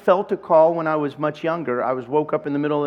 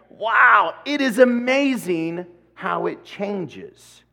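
mock amazement; 5 semitones higher, much slower than usual. Marvelling at change.

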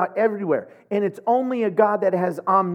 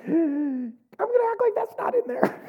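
much higher, playful put-on voice; mock sheepish. Confessing how he skips the psalm, a high playful sheepish voice. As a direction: sneak past the verse like a guilty kid. Pretending to skip scary psalm.

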